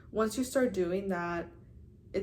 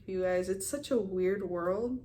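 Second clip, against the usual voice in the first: usual speaking voice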